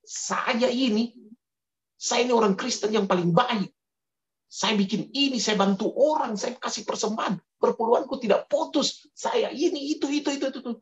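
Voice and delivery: outraged self-righteous boasting; angry indignant protest. Acted. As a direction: complain like an offended churchgoer. Dia marah... Dia bilang begini.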